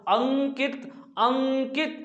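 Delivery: अंकित with the opening अं held long and nasal; nasal dot sounded out. Shown as a demonstration. Teacher showing the anusvara in अंकित, drawn-out nasal demonstration. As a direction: stretch the nasal first syllable of अंकित, then add कित. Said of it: "अंकी बिंदी बोली गई".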